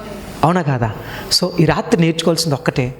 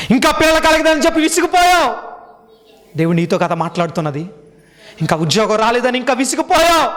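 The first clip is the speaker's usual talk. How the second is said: preaching at full force; 8 semitones higher than usual. Acted. Pastor rebukes believers who gave up on God, preaching at full force. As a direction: drive each question home to the crowd.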